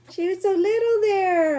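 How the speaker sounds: high cooing voice. Real, not acted. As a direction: coo at the puppy photo. Cooing over puppy photo.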